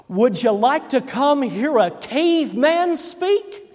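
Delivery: slow build-up on each word; enticing a child. Retold or imitated. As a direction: offer it to a kid like a big treat. Pastor invites a little boy.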